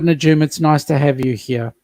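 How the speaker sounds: normal talking voice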